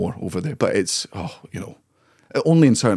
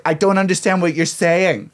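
higher-pitched mock incomprehension; baffled Americans; 7 semitones higher than usual. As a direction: play an uncomprehending American customer. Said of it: "and they'll be like".

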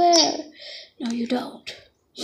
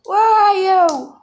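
loud, higher calling for a lost friend; 5 semitones higher, 5 dB louder than usual. Pretend. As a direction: shout out searching for a friend.

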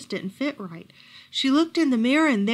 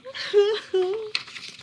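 sob; 10 semitones higher than usual. A narrator's reading. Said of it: "she began to sob".

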